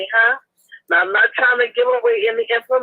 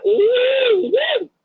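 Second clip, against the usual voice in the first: high, rising excited "ooh, woo"; excited squeal; 13 semitones higher than usual. Retold or imitated. Telling the neighbors they'll be in Vegas, a high excited squeal. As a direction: squeal it like hearing juicy gossip. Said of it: "I said".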